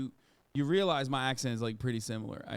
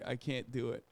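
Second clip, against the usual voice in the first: normal talking voice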